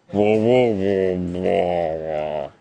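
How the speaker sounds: very low, mushy mumbling; mock a deep-voiced man with a stuffed mouth; deep muffled nonsense syllables; quark-in-mouth imitation. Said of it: "ein Kilo Quark im Mund".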